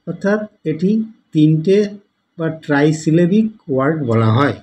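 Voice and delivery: ordinary talk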